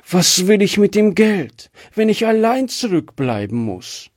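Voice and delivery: high-pitched, acted plaintive distress; understated tearful plea, pitched high; sobbing. A narrator's reading. Wife fearing to be left alone, mildly sobbing. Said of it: "schluchzte sie".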